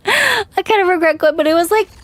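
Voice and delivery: laughing repeat, pitched high. Real, not acted. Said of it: "laughs, repeats line higher".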